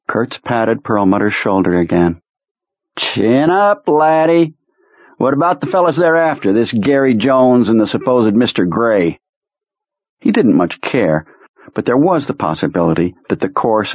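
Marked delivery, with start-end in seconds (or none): 3.0-9.2 high, brisk, jolly-handed officer; hearty commander. Acted. Boss coaxing the sick man, lightly brisk and hearty. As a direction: cheer him up briskly. Kurtz's voice in story.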